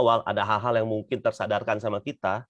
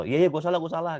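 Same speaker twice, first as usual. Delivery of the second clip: dismissive apology; 7 semitones higher than usual. Retold or imitated. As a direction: brush it off, quick and annoyed. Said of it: "Beda kalau". (minta maaf dengan nada ketus, asal-asalan)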